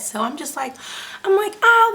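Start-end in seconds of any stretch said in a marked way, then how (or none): 1.6-1.9 loud, high "ah" of pain; retold pained yelp. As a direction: yelp as if cut. Retold pain at feeling the scalpel.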